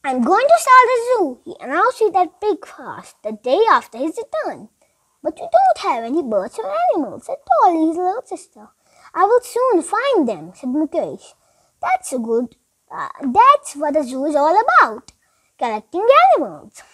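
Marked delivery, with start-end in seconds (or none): none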